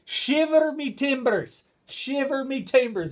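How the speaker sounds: high, loud, drawn-out exclamation; hammed-up commentator's cry of amazement; Alan McManus. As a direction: belt it out slowly like an amazed commentator. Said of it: "comes from Alan McManus's heart".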